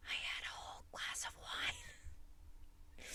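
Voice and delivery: slow, breathless confiding whisper; secret whisper. Retold or imitated. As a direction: lean in and confess it in secret. Leaning in to confess, drunk.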